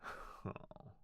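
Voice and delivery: a breathy, resigned sigh; unvoiced sigh. Butler sighs as the lady hides in bed, soft resigned sigh. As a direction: let out a soft tired sigh. Lady still refuses to wake.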